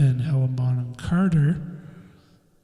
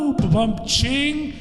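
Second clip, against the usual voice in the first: rimshot; 9 semitones higher than usual. Shown as a demonstration. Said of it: "That's my little joke".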